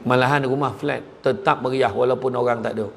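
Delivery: ordinary talk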